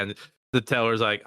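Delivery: ordinary talk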